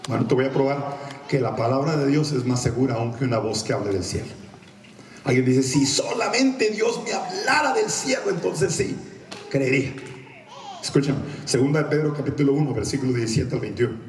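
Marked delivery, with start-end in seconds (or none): 5.6-9.9 wishful doubter. Acted. Acts out doubter's wish.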